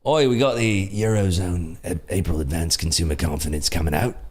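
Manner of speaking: mock London accent. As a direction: imitate a Londoner calling the news. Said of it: "Some person's talking in a London accent".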